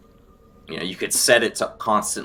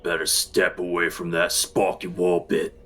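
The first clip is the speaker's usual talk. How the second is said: a lightly guttural, menacing warning; hint of a guttural growler at gunpoint. Game master voices attacker with gun to player's head, guttural. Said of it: "a low, guttural voice say".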